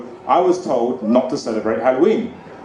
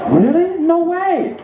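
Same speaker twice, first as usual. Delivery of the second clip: amazed lady; slower than usual. Retold or imitated. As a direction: squeal it in surprise. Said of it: "She's like".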